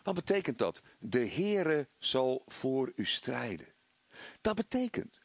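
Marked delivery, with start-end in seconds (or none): 1.0-3.7 slow, weighty scripture quote, word by word; solemn scripture. Acted. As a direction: let each word of the promise land slowly. (plechtig en langzaam citeren)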